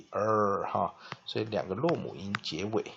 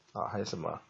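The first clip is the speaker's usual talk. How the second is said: ordinary delivery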